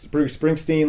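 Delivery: ordinary delivery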